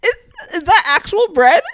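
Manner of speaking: high, loud and laughing. Real, not acted. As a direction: ask it while cracking up. Asks through laughter.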